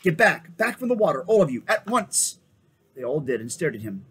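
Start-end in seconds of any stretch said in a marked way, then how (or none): 0.0-2.4 loud, higher commanding shout; sharp shout. A narrator's reading. Edmund orders his friends away from the water, sharp loud shout. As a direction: order everyone back at once. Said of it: "shouted out in a sharp voice".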